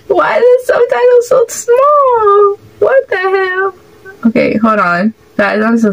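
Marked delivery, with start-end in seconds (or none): 0.1-3.7 high, half-crying whine; high teary whine; whiny complaint. Real, not acted. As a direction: whimper about the tiny subtitles. Complaining about tiny subtitles.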